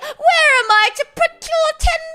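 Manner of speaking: high, loud, hammed-up wailing; theatrical sobbing lament; mock crying. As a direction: wail like a melodramatic victim. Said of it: "would cry and say".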